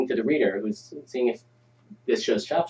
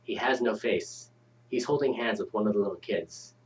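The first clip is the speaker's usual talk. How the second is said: ominous story narration; 3 semitones lower, 6 dB quieter than usual. Acted. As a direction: read it like a horror story. Reads scene setting ominously.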